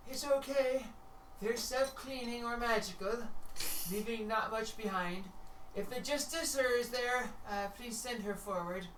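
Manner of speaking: high, sing-song reassurance; bright high creature voice; Tia's high voice. Acted. Tia calling for the Justicer, high and cheery. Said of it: "she goes".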